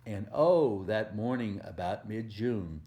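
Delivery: usual speaking voice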